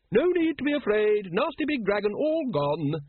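high, bright coaxing to frightened dragons; bright reassurance. A narrator's reading. Keeper brightly soothing her nervous dragons, high and cheerful. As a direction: sing it out cheerily to calm them. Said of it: "she said brightly".

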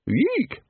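very high short eek; sudden high animal squeal; ape's squeak. Acted. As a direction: squeak like a small ape. Librarian's squeak imitated.

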